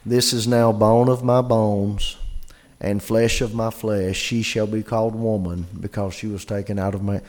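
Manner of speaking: bored, deadpan recital of Adam's words; flat monotone reading. Shown as a demonstration. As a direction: read it like a dull list with no wonder. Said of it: "I've got a feeling it was not".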